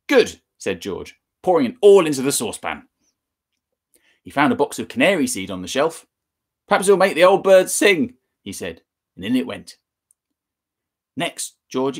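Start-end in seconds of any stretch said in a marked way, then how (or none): 0.1-0.4 bright, high and loud; pleased little cry; gleeful. Acted. George pleased, tipping in powder. 6.7-8.2 high, loud and cheeky; mischievous glee. Acted. George's cheeky remark.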